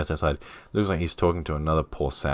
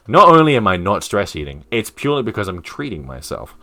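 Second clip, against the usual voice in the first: indignant, starting loud and raised; defensive protest; 3 semitones higher, 5 dB louder than usual. Acted. Robert points out the ice cream, indignant self-defence. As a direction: protest too much.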